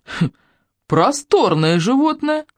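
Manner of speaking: slow, high amused appraisal; impressed grandpa musing; wry old man. Acted.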